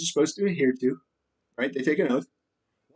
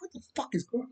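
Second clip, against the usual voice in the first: bewildered hush; 8 semitones higher, 14 dB quieter, faster than usual. Retold or imitated. Imagined victim reacting to darts.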